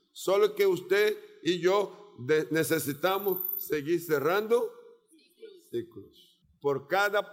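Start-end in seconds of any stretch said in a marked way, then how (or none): none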